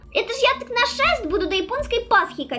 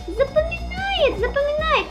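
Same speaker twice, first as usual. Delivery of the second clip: high sing-song; 11 semitones higher than usual. Acted. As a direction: stretch it out, high and teasing. Mocking voice, game repeats her.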